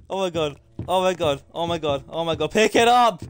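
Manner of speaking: usual speaking voice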